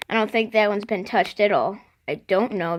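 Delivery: ordinary talk, nothing marked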